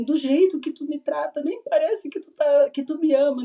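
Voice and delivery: half-acted hurt, as if crying to her husband; retold crying plea. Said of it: "Chorando, assim".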